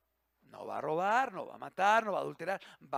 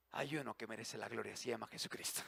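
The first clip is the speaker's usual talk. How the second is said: hushed reverence; 17 dB quieter, faster than usual. Real, not acted. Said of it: "hushed reverent climax".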